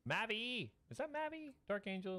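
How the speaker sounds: high, delighted surprised greeting; excited. Real, not acted. Excited greeting to chat viewer.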